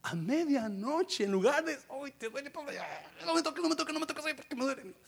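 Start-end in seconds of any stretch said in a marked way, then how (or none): 1.9-4.9 high, whiny mock complaint, don't touch me; hammed-up sore prisoner whimpering; whiny pained prisoner. [name] whining to Pablo about his aching body in a high, whiny voice.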